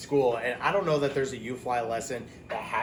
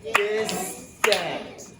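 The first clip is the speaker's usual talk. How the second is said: normal talking voice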